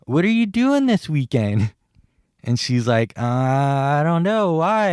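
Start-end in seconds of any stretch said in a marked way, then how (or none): 0.0-1.7 high, sing-out question; retold pick-up line, pitched up; eager asking. Retelling an eager first approach. 3.2-4.9 drawn-out uncertainty rising to a high why; hesitant, puzzled. Retold or imitated. Acting her puzzled, wary reply.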